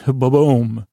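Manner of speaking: normal talking voice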